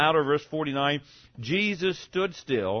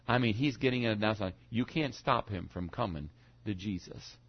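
ordinary talk, nothing marked

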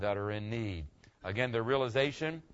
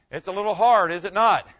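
teasing; 7 semitones higher, 12 dB louder than usual. Real, not acted. Loud teasing question.

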